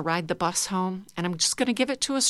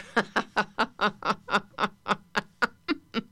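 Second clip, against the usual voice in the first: laughing a little softer; 3 semitones higher than usual. Shown as a demonstration. Teacher bringing the class laughter down, softer. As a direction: ease the laugh down. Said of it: "And a little softer".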